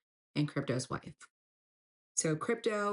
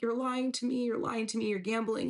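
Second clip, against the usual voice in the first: higher-pitched upset accusation; retold tearful accusation; 6 semitones higher than usual. Wife confronts partner over gambling, retold tearful accusation. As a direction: accuse him in a raised pitch, retelling it.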